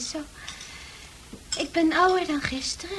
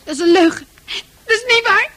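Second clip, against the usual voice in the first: loud, higher outraged cry; angry protest; 6 semitones higher, 8 dB louder, faster than usual. Acted. Francis shouts at the countess that it is a lie.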